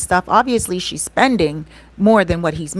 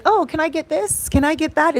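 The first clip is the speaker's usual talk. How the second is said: high, excited mock calling out to vendors; imitating her own eager self, higher; eager shopper; 10 semitones higher than usual. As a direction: pitch up and chirp like you want everything. Imitating herself calling vendors over.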